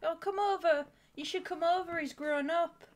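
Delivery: imitating doting sister. Sister urging a visit to dog, high mock gushing. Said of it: "It's like".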